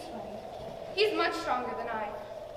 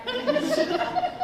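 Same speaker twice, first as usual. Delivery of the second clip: high trilling laugh; 14 semitones higher, 3 dB louder than usual. Acted. Fairy wind tempts the girl.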